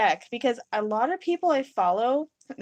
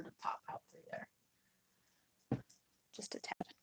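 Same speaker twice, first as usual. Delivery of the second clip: whisper; 16 dB quieter than usual. Real, not acted. Quiet aside while adding pigment.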